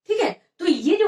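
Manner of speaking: usual speaking voice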